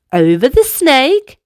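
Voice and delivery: ordinary delivery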